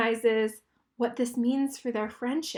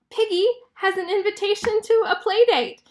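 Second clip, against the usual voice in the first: ordinary delivery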